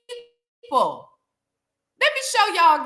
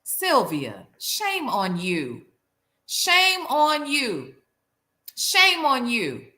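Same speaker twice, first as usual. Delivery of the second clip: slow scolding; 3 semitones lower, slower than usual. Real, not acted. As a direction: shame on you, drawn out.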